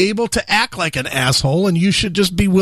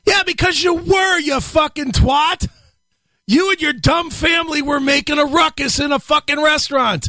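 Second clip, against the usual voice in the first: mock shouting; 7 semitones higher than usual. Yelling insults at the clip.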